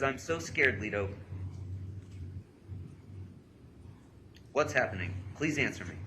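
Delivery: loud read-aloud of a scared plea; punched, insistent reading; urgent pleading. Retold or imitated. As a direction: read her frightened texts out forcefully. Reads girl's scared texts aloud.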